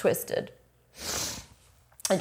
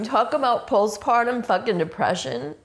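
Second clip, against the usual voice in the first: ordinary delivery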